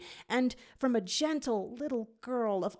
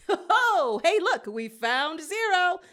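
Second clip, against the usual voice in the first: much higher, louder laughing shout; 10 semitones higher, 7 dB louder than usual. A narrator's reading. Pronto spots Zero, laughing high and loud. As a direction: laugh it out joyfully. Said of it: "Pronto laughs".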